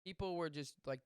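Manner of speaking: normal talking voice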